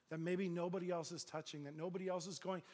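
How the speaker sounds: ordinary delivery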